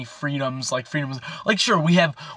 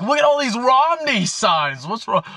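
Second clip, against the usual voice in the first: higher, slow, incredulous "look at all these Romney signs"; mocking indignation; 8 semitones higher than usual. To the cop, pointing at Romney signs, slow mock disbelief. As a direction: act baffled at the unfairness. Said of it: "I'm like".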